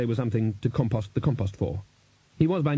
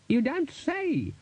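very high, stretched-out character voice; the Chair's retort; much slower than usual. A narrator's reading. Wizard chair fleeing from a possible emanation, high drawn-out retort. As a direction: draw each word out up high.

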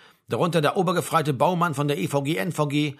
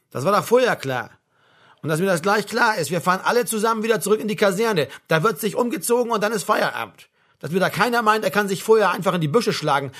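higher, insistent sergeant's voice; brusque sergeant major; 4 semitones higher, 3 dB louder than usual. Acted. Sergeant major warning men not to slip away, brusque. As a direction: lay down the law to the men.

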